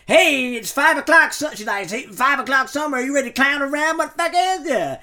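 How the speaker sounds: bad impression of an American mum; Ma's American accent. Host mimicking Ma in an American accent, lightly done. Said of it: "Mars accent".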